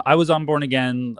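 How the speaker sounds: ordinary talk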